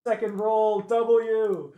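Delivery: high hype call; excited. Real, not acted. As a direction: celebrate the roll. Hype on win.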